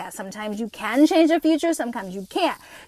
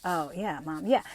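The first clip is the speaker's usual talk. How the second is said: put-on bored teen son; 6 semitones lower, 8 dB quieter than usual. Son brushing off his mom.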